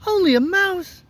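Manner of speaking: high-pitched, affronted little mouse; indignant squeak. Acted. Little mouse offended by the woodman, indignant squeak.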